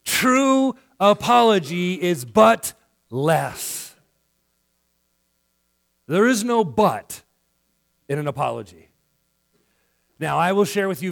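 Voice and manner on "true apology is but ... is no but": louder, very slow, each word weighed; slow, loud sermon punchline; slow emphatic point. Real, not acted. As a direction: drive the point home slowly. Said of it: "punchline, slow and loud".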